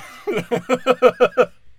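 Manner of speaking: loud high laughter; laughing hard after the German line. Real, not acted. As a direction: crack up loudly. Laughs at own joke.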